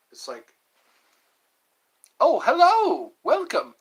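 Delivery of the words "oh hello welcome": high, loud mock-cheery welcome; hammed-up hostess welcome, pitched way up; over-bright greeting. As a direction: belt out an exaggerated hello through your misery.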